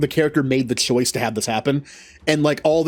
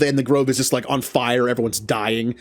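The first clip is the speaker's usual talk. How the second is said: normal talking voice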